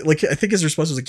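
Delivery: usual speaking voice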